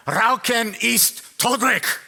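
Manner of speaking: grim warning label in mock-scary German. Shown as a demonstration. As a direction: make the German sound menacing, slowly. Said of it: "but it sounds scary".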